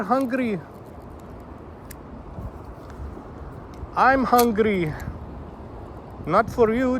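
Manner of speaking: high, coaxing Southern-tinged call to a fish. Real, not acted. Pleading with the fish to come back, high and coaxing. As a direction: sweet-talk the fish into biting.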